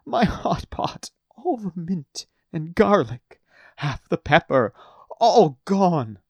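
high, loud lament over the ruined stew; bawling lament. Acted. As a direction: wail the loss item by item. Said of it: "bawled Gambo uncontrollably".

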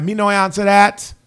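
imitating a Jamaican sprinter in a put-on Jamaican accent; mock Jamaican accent.

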